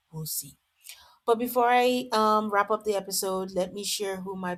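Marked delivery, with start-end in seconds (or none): 0.1-0.6 whispered aside. Real, not acted. Trailing aside to viewers.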